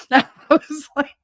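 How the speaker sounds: normal talking voice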